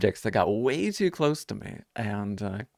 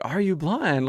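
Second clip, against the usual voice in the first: higher, blunt, rude asking; rude question; 7 semitones higher, slower than usual. Retold or imitated. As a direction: blurt it out with no tact. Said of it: "so rudely".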